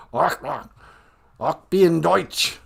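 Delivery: throat-clearing fake German; mock German. Joking that German sounds like coughing, mock German. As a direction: hack out guttural German sounds. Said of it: "gonna cough up a loogie".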